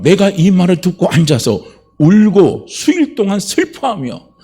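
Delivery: slow, measured reading of the verse; solemn scripture reading. Acted. Preacher reading Nehemiah to the congregation, slow and solemn. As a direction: read the verse slowly and gravely. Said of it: "뭐라면".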